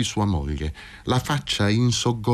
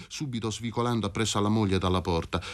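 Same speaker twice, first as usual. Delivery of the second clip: ordinary delivery, nothing marked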